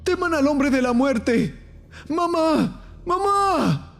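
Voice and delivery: very high, frantic creature cries; piercingly high, desperate little voice; screaming chest creatures. A narrator's reading. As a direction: shriek like a creature calling for its mother. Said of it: "Los gritos aumentaron".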